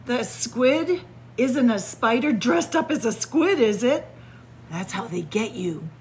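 plain reading voice

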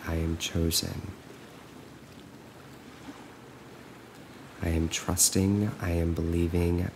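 calm, even affirmations with long silences between; guided meditation. Acted. Coach looping affirmations for listeners to follow, calm and measured. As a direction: recite evenly, leaving room to repeat.

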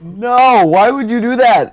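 outraged cry at a teammate who attacked; upset shout. Real, not acted. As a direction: yell it as if a friend just betrayed you. Player killed in game.

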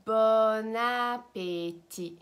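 slow, loud "Bon appétit" with no T at the end; silent final T. Shown as a demonstration. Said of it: "Do not pronounce the T".

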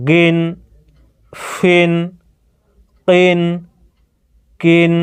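each syllable ending in ইন said alone, clear and separated by pauses; pronunciation demo. Shown as a demonstration. Teacher showing students the double-kasra sounds, slow isolated syllables. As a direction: model every sound crisply for students to copy. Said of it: "এবারে উচ্চারণটি দেখেন".

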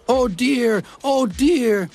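high groaning lament. Acted. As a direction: moan it out, high and sorry. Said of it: "they groaned".

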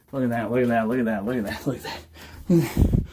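low, soft hammed-up wonder; low mock awe. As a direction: murmur it in fake amazement. Mock awed tour of bathroom.

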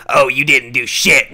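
loud mock-irate caller; angry parting shot. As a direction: snap it loudly before hanging up.

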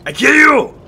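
high, loud hammed-up war cry; mock barbarian threat. Player threatening the beast mid-fight, hammed-up war cry. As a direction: roar it like a crazed barbarian. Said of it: "my most violent series".

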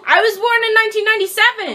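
fast, high-pitched retort; defensive protest. Real, not acted. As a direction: rattle it off high, defending yourself. Bickering over being a millennial.

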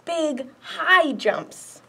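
slow, gentle storytime read; story reading. A narrator's reading. Librarian reading a picture book to kids, gently. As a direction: read it out to little ones.

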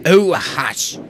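sharp, annoyed interruption; irritated snap. A narrator's reading. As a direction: shut them up crossly. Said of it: "Alan glared".

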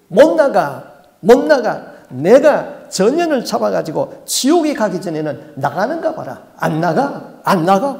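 loud, high snarled refusal; the demon shouting that it won't leave; defiant demon. Retold or imitated. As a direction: refuse like a cornered spirit.

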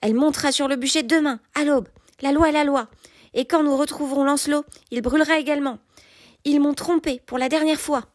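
high-pitched, vehement royal outburst; indignant decree, held high throughout; crying out. A narrator's reading. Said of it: "m'écriai-je".

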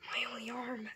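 whispered, hammed-up sorrow; mock grief whisper. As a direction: breathe it out like a dying hero. Toy mourns lost arm.

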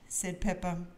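plain reading voice

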